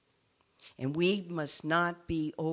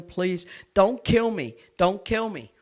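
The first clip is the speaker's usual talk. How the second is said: desperate pleading; 3 semitones higher, 9 dB louder than usual. Retold or imitated. Condemned man begging the guards at the execution, loud desperate plea. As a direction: cry out to the guards not to kill him. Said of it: "began to scream and to cry".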